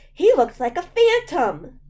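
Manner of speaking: high, loud sudden alarm; a spike of fright; frightened. A narrator's reading. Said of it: "the frightening thought flashed".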